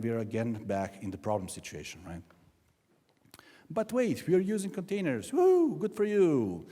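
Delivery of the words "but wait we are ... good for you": high-pitched, hammed-up enthusiasm; mock excitement. Speaker mocks developers proudly using containers, mock excitement.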